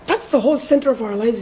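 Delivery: normal talking voice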